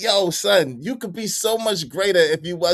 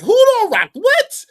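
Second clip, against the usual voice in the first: much higher, louder squeak of disbelief; shocked; 6 dB louder, slower than usual. Real, not acted. Streamer baffled by chat, much higher squeal. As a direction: squeal it like you can't believe it.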